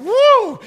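high, loud "woo" whoop; excited whoop. Retold or imitated. Pastor imitating his car racing downhill, excited whoop. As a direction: whoop like sliding down a hill.

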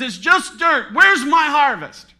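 loud, high mock complaint; hammed-up indignant outcry; complaining farmer. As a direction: demand it as a frustrated farmer. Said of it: "The farmer looks at the ground and he says".

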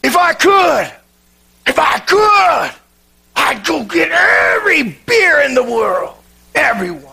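preacher yelling against alcohol at the end of his sermon. Retold or imitated. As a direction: shout it like a pastor steaming at the pulpit. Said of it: "he began to yell out".

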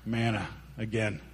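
low, soft, fed-up groan; mock dismay, dropped low and quiet; weary grumbling. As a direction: let all the enthusiasm drain out. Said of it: "then their attitude changes to".

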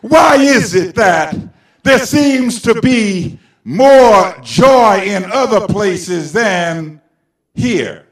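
loud, slow, ringing sermon cry; shouting preacher, every phrase hammered slowly; preaching at full force. Acted. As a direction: drive it home to the congregation.

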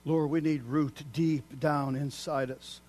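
ordinary delivery

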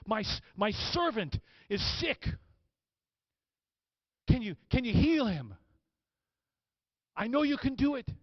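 high, fervent appeal; earnest pleading. Acted. Roman soldier begging Jesus for his servant, pleading. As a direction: plead with rising conviction.

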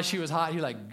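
usual speaking voice

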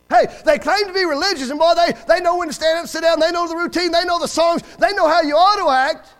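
high, loud, driving list; preaching denunciation. Real, not acted. Preacher rails at religious hypocrites.

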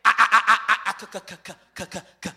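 loud mock stutter breaking off; stammering Moses. Moses refuses God, comic loud stutter. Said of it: "And Moses said".

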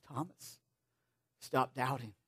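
Jesus gently telling Thomas he is loved, hushed; tender plea. Retold or imitated. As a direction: lovingly urge him to stop doubting. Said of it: "what he was really saying to Thomas".

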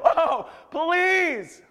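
loud, high mock wail; hammed-up idol worshipper's plea; desperate pleading. Idol worshippers crying out to Baal, desperate. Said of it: "the picture that we get of worshiping an idol".

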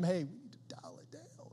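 whispered request to quiet down; hushed whisper. Acted. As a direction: whisper it, as if hushing the kids. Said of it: "turn the volume down on them".

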